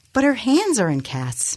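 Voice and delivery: high-pitched protest; protesting objection. A narrator's reading. Nemesis objecting to office manager's plan, high-pitched protest.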